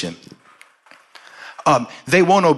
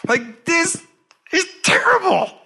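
put-on much higher, childlike whiny owie cries; mock whimpering; slower than usual. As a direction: whimper like a hurt child to Daddy. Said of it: "I was just like".

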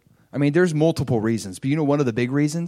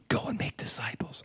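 much softer unvoiced whisper; whispered voice of God; 3 semitones lower, 13 dB quieter than usual. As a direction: breathe God's quiet call. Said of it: "He's saying the same thing".

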